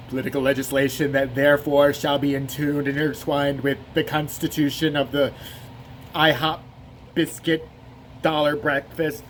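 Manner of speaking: put-on learned oratory; mock-intellectual. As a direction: sound grandly official, then fumble it. Mock smart speech, then loses it.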